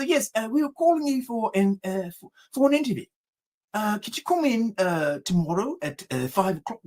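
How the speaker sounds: measured, put-on official phone voice; pretend executive. Prank caller inviting his friend to an interview, pretend executive voice. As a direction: offer it formally as a fake executive. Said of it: "pretended to be an executive".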